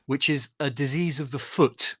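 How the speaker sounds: ordinary talk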